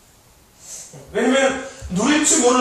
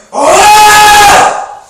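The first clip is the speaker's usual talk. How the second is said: lion roar; 13 semitones higher, 16 dB louder than usual. Pretend. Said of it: "어떻게 울어?".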